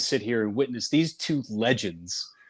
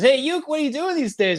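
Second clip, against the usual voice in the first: put-on much higher old-legend voice; Willie Mays voice; 13 semitones higher than usual. Willie Mays asks Uke what he's up to, voiced high. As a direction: lift it way up for the friendly legend. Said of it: "Willie says".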